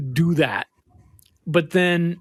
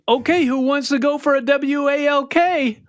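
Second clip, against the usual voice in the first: put-on high, louder baby talk to a dog; excited dog owner; 10 semitones higher, 4 dB louder than usual. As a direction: work the dog up with mock excitement. Imitating owners talking to their dog.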